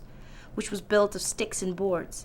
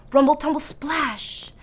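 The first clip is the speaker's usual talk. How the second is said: dramatic; 6 semitones higher than usual. A narrator's reading. Dramatic sound words.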